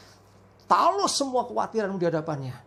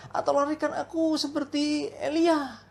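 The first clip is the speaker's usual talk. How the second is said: high, pleading plea, half-hammed; mock desperate prayer; 8 semitones higher than usual. Late traveller praying for rescue, mock pleading. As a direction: beg God to whisk him away. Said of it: "Dia doa".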